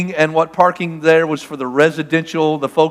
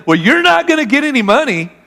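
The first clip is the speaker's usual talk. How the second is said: escalating outrage; 8 semitones higher than usual. Retold or imitated. Preacher retelling how he argued back with the taxi drivers, escalating outrage. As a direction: push back harder with each threat. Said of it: "we're ratcheting it up".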